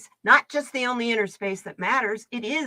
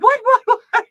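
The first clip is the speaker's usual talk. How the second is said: startled friend; 13 semitones higher, 11 dB louder than usual. Retold or imitated. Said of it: "she's like".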